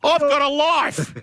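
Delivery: high, drawn-out protest; indignant retort. Real, not acted. Indignant retort in mock quarrel.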